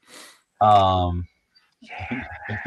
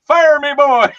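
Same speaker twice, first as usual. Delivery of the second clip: big, much higher and louder yell; shouted catchphrase; 12 dB louder than usual. Retold or imitated. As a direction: bellow it like the cartoon hero. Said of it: "and I go".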